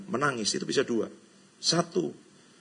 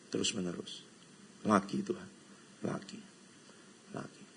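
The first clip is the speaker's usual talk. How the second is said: fading plea; 7 semitones lower, 16 dB quieter than usual. Acted. Preacher echoes a plea to God, fading away. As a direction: lower and fading almost to nothing.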